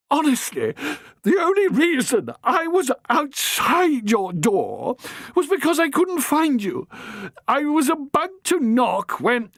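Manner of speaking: put-on high, flustered, faltering young man; stammering young man. Harry pleads with the matron, stammering. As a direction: trip over the excuse.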